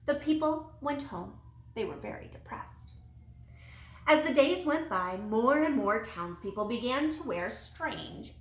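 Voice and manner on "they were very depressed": whispered, much softer and sinking; gloomy hush. A narrator's reading. Narrator telling how the townsfolk despaired, hushed and glum. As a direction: let the words sag into gloom.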